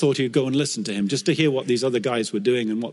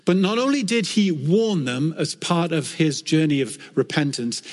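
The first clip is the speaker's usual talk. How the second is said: normal talking voice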